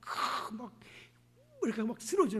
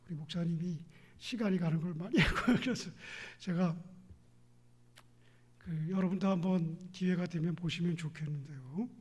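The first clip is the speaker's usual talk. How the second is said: usual speaking voice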